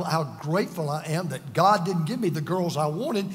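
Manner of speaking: ordinary talk, nothing marked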